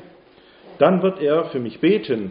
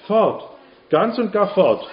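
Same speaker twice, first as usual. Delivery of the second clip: higher, louder cry of wonder; joyful amazement; 8 semitones higher, 4 dB louder than usual. A narrator's reading. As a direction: exclaim it like a miracle just happened. Healing miracle, joyful exclamation. (freudig staunend, höher und lauter)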